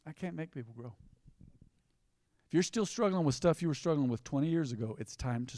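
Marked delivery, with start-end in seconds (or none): 0.1-0.9 hushed repeat for emphasis; much softer repeat. Shown as a demonstration. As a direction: drop way down and say it softly.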